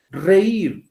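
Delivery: "Reír" high and loud on the í; stress on I. Shown as a demonstration. Teacher showing why the vowels separate, high stressed í. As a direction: hit the í of "Reír" as its own syllable. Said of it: "la I tiene la mayor fuerza de voz".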